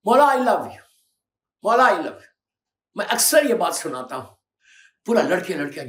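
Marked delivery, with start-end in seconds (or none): 0.1-2.4 louder, higher call of devotion; imitating a devoted cry; mock fervent cry. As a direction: cry it out to the Imam. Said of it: "हम जैसा बोलते हैं ना".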